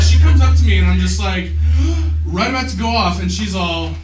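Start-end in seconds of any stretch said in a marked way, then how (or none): 1.6-2.2 sharp, high, loud gasp. Retold or imitated. Teen about to go off at the girl, gasping.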